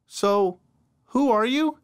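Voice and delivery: put-on curious young woman asking. A narrator's reading. Narrator voices the amnesiac girl.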